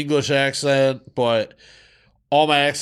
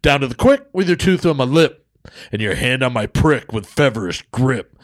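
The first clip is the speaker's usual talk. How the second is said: Def Jam poetry slam; 3 semitones higher, 3 dB louder than usual. Acted. Co-host performing a crude poem for listeners, emphatic slam delivery. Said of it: "I'm gonna do it like a fucking, a Def Jam".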